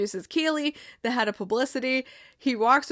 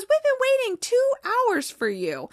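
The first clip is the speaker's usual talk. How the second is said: higher, mock exasperated boss voice; mock annoyed boss; 11 semitones higher than usual. Boss scolding a late star player, higher and exasperated. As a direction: play the fed-up boss, pitched up.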